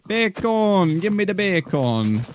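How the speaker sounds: silly demand. Pretend. Demanding bacon in game.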